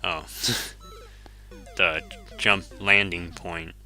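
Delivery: ordinary talk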